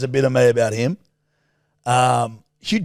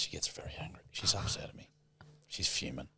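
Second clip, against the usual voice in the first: hushed aside about her, unvoiced; a conspiratorial mock whisper to the audience; stage whisper; 11 semitones lower, 20 dB quieter than usual. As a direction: whisper it behind her back.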